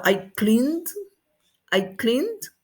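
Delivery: "cleaned" with the ending released after N; final D demo. Shown as a demonstration. Teacher models past tense for class, "cleaned" ending clearly sounded. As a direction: lift "cleaned" and click off its last consonant. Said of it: "pero suena como D".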